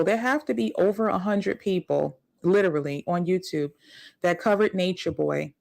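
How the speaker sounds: usual speaking voice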